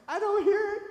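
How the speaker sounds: high, loud sassy retort; playful mock yell; mock shout. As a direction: belt it out teasingly.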